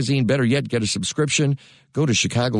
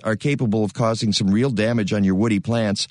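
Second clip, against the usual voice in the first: normal talking voice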